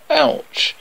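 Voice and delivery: high, sharp cry of pain; pained yelp. Acted. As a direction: yelp when the swatter hits. Boy whacked again.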